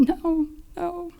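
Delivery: a broken, crying no, no; mild retold sobbing no. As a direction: let the no, no break into tears.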